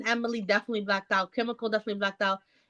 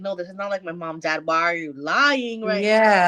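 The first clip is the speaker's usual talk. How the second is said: pretend daughter; 4 semitones lower, 4 dB louder than usual. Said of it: "she's gonna be like".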